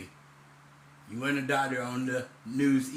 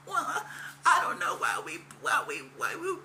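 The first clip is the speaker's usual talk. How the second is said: very high, fake-teary stammering whine; hammed-up blubbering imitation of a flustered news guest; mocking whiny newsman; 13 semitones higher than usual. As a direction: mimic him flustered and near tears, pitched way up. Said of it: "That's exactly how you did him".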